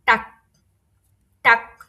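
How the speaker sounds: "টাক" ending in a k sound; final g as k. Shown as a demonstration. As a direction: model "টাক" with a crisp k at the end. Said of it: "এটা কয়ের মতো উচ্চারণ হবে".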